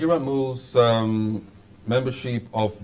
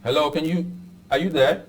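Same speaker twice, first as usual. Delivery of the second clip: to someone hard of hearing; 6 semitones higher, 3 dB louder than usual. Real, not acted. Host calls to silent line.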